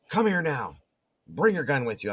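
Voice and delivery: high, urgent order; urgent command. Retold or imitated. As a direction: demand it with alarm. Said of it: "She was like".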